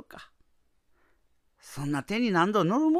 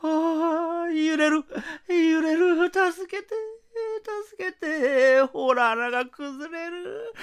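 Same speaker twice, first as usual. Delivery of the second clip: higher, terrified pleading; panicked cries for help; 7 semitones higher, slower than usual. Acted. Cave collapsing, wife panics.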